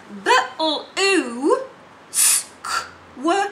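"but-ul-oo s-k-w-air" very slow, pieces; segmented words. Shown as a demonstration. Teacher sounding out blue square. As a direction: sound out each part slowly. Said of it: "Try and do the sounds".

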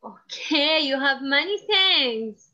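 ordinary talk

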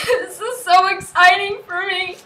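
real crying laugh of joy; laughing through tears. Real, not acted. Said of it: "I'm sorry for the tears".

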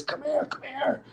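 high, soft mock call; imitated calling over. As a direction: beckon someone over in a high, hushed voice. Retold Richard calling him over.

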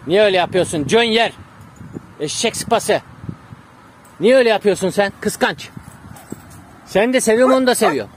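loud, exasperated reproach to a pet; scolding the dog. Real, not acted. Scolding his jealous dog.

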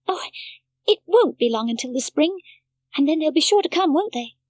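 put-on a child hoping to be reassured; Heidi's voice. A narrator's reading. Heidi asks the doctor hopefully, lightly childlike.

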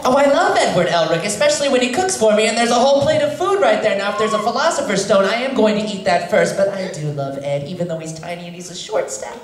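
high, bright-eyed hero voice that drops back down toward the end; Link voice. Pretend. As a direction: play a young hero gushing about his friend. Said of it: "in Link's voice".

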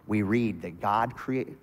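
normal talking voice

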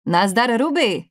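light call-out, rising on the name; understated calling out, pitch lifted; called greeting. A narrator's reading. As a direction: hail someone passing without really shouting. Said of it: "křikl na ni".

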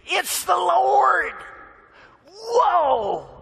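high, loud, slow gasp of recognition; stunned recognition. Acted. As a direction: realize in awe who it was. Disciples recognize Jesus.

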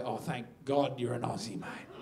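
low, soft and confiding; relieved caller. Acted. Caller finding a fellow Aussie on the line, quietly relieved.

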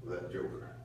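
low, soft, mock-sulky grumble; sullen refusal. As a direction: play the brother digging his heels in, pitch dropped. Voices angry brother refusing to go in.

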